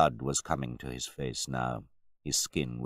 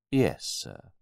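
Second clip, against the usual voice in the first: grave butler; 4 semitones higher than usual. A narrator's reading. Butler endures the mockery.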